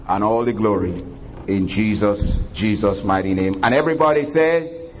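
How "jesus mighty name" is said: ordinary talk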